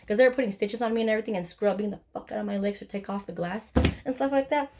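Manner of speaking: ordinary talk, nothing marked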